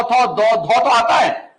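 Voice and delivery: थौ, दौ, धौ each said slowly and separately; teacher sounding out the dental syllables slowly; slow syllable demo. Shown as a demonstration. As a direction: spell out थौ दौ धौ slowly. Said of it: "दंत में हमने देखा कि तौ".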